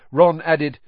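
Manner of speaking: ordinary delivery, nothing marked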